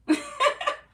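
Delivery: short high-pitched laugh; quick burst of high laughter; real laugh. Real, not acted. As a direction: laugh high at your own comeback. Laughs after own clapback.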